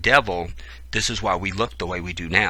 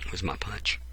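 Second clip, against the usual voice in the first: whispered aside about the punch; hushed, breathy whisper; 2 semitones lower, 9 dB quieter than usual. Real, not acted. Whispering an aside to the room while reading.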